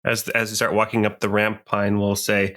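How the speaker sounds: ordinary delivery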